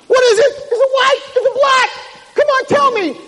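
very high, loud frantic questions; high-pitched desperate yelling; frantic panic. Retold or imitated. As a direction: demand an answer while jumping with nerves. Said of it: "he's standing there and he's jumping".

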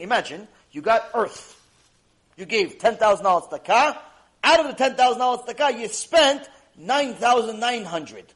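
usual speaking voice

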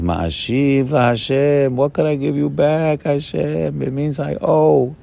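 King David's plea. Retold or imitated. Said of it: "And he says".

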